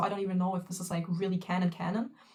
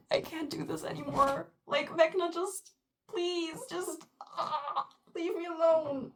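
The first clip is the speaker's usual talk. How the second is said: pleading near tears; 7 semitones higher than usual. Real, not acted. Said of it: "you see the tear in my eyes".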